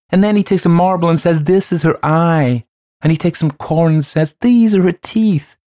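put-on high, loud, breathless-eager child rattling it off; happy excited child. A narrator's reading. Child spoils the game for everyone, crying happily. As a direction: gleefully spill the secrets in a child's high voice. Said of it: "some child cried happily in the dark".

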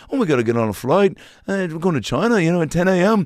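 high imitated rock star making excuses. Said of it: "And he's like".

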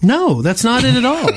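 high, laughing no; laughing denial. Real, not acted. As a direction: deny it through laughter. Denying tease while laughing.

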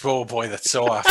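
ordinary talk

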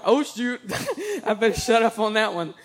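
words through real laughter; laughing. Real, not acted. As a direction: giggle through the line. Catches herself, laughing.

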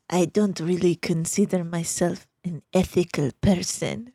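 low, soft put-on Italian accent. Host mocks the star's trailer line, low Italian accent. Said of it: "And it's [name] like".

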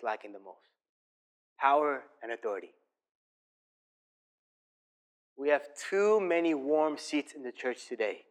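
low, hushed preaching with long pauses; solemn appeal. Real, not acted. Preacher urging the church to seek power, hushed and solemn. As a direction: bring it right down, low and grave.

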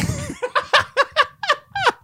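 squealing laugh way up; high laugh. Real, not acted. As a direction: shriek with laughter. Laughing at the bit.

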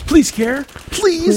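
high-pitched mock begging; mock pleading. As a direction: beg in a hammed-up high voice. Voices broadcast begging viewers to care.

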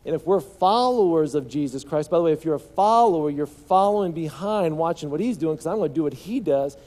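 rising preacher's call to mission; preaching at full force. Acted. Preaching climax.

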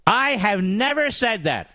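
"I have never said that" spelled out high and slow; emphatic disclaimer. Real, not acted. Host distances himself from a rumor, high slow stress on "never". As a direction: stretch "never" high, in full words.

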